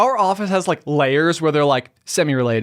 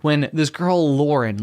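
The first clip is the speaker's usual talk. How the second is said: usual speaking voice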